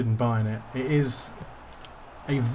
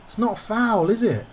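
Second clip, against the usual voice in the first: indignant disbelief; 7 semitones higher than usual. Real, not acted. Disputing a foul given.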